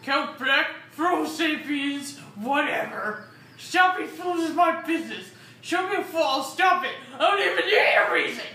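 loud cocky brag; boastful tough guy. Acted. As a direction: brag it big and proud. Boastful character voice.

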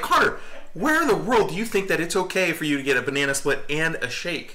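high, fast incredulous questioning; playfully exasperated parent cross-examining a kid; mock scolding. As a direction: rush out a retold parental telling-off. Retelling a parent questioning the child.

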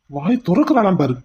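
ordinary delivery

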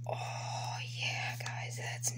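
soft unvoiced whisper; a real hushed whisper over the card. Real, not acted. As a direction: breathe it in hushed awe. Admiring the card closely.

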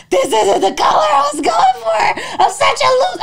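high loud laughing wail, slowed; hammed-up despair; over her hair, mock sobbing loudly; mock crying wail. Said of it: "I'm, like, crying".

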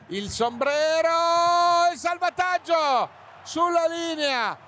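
high, loud, slow drawn-out shout; excited shout. Real, not acted. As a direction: cry out at the clearance. Goal-line clearance, commentator shouts. (urlo concitato)

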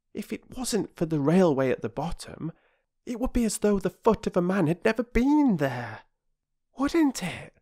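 put-on high, light girl's voice wondering aloud; a small girl marvelling at the gorge; Phyllis, a young girl. A narrator's reading. Little sister musing to her siblings, high and wondering. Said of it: "as Phyllis said".

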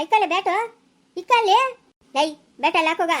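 sharp rising order; loud command to put it down; scolding shout. Acted.

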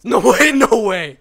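loud, high, laughing "no way"; laughing disbelief. Real, not acted. Gamer who just survived, loud and laughing.

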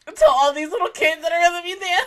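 ordinary talk

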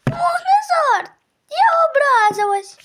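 high, put-on kitten voice, sulking; offended kitten. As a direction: pout it out as a hurt little kitten. Kitten sister sulks at brother.

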